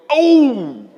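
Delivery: loud, high gangster oh; shocked mob guy gasp; Sopranos-style surprised oh. Retold or imitated. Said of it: "they hear something surprising, they go".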